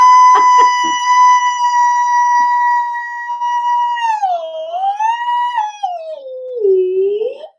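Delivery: long high-pitched squealing noise; high annoying squeal. Pretend. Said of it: "do annoying sounds 'cause he won't stop".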